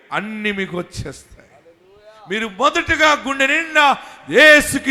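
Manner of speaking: fiery sermon build; full-force preaching. Acted. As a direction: preach it out to the whole church.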